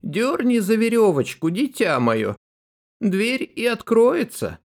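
put-on high old granny calling out; grandmother calling. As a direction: call from bed like a grandma. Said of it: "и крикнула".

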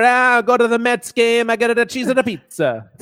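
camp up a New Yorker, sliding into Italian; bad New York accent. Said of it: "it just turns Italian every fucking time".

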